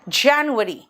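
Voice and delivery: जनवरी modelled as "JAN-yu-ary"; English pronunciation. Shown as a demonstration. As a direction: stress an open "jaen" in जनवरी. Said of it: "pronunciation अब ध्यान से सुनना". (जनवरी का अंग्रेज़ी उच्चारण)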